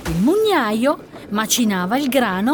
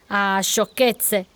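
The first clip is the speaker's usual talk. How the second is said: ordinary delivery, nothing marked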